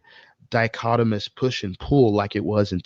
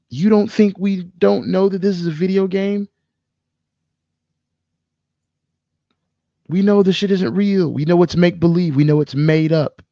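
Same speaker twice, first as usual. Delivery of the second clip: heated rant; 8 semitones higher than usual. Real, not acted. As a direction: push it up like you're fed up with them. Soapbox rant about video game movies.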